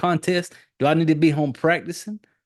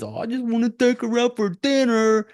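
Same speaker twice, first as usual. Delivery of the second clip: high mocking whiny voice; mocking the lovesick ex-boyfriend; mocking Rick; 9 semitones higher than usual. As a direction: mimic him as a sappy fool, pitched way up. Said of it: "the Tanya girl that he says".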